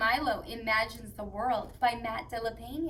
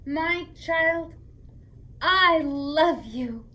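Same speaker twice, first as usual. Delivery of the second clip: louder, slow, love you; proud declaration; 4 semitones higher, 7 dB louder, much slower than usual. Acted. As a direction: say it out loud with pride. Story climax, parent to child.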